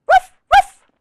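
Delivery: high, loud double woof; puppy woof. Acted. Biscuit answering the reader, high loud puppy woofs. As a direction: yip like an eager little puppy. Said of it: "And Biscuit says".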